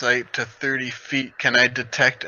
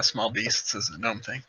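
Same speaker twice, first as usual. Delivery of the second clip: normal talking voice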